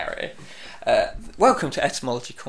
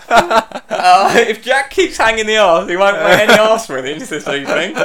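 louder, talking through laughter; can barely get it out for giggling; laughing; 6 semitones higher, 8 dB louder than usual. Real, not acted. Giggling through his own joke.

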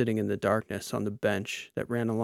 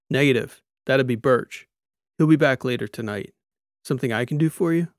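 louder, brisk friendly cyborg; cyborg Soch; 2 semitones higher, 6 dB louder than usual. Acted. Soch telling Lita the captain is out, brisk cyborg voice. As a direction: answer like an easygoing mechanic cyborg.

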